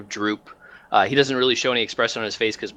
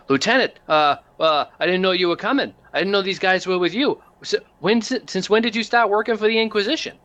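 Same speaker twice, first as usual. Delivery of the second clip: flustered tech-priest; 9 semitones higher than usual. Acted. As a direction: stammer out surprise at seeing the lieutenant. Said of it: "He goes".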